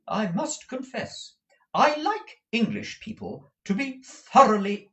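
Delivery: high, opinionated motherly declaration; high-voiced, swooping insistence; emphatic. A narrator's reading. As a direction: declare it firmly, punching key words. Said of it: "Missus Nickleby emphatically".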